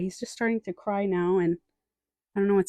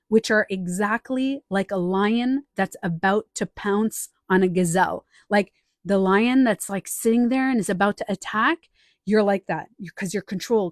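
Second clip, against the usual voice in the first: ordinary delivery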